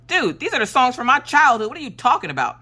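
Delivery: indignant parent, pitch jumping up; mock indignation. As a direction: protest as if personally offended. Acted indignant retort to her son.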